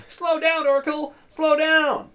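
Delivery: high, loud panicked yelling; hammed-up panic, pitch way up; mock freak-out. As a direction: screech it like a terrified passenger. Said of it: "Paul Giamatti is freaking out".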